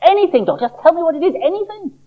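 high, eager little-seed voice; an impatient seed begging to know; Sammy the seed. Acted. Sammy pleads.

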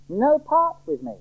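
high, slow warning; deliberate final pronouncement; uncompromising warning. Acted. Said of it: "is an uncompromising warning".

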